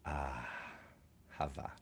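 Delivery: usual speaking voice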